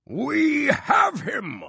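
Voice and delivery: very slow, high, loud triumphant shout; roar. A narrator's reading. Magician roaring in triumph to the crowd. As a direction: drag out the victory cry. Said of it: "Bosso roared".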